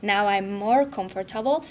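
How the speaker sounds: ordinary talk, nothing marked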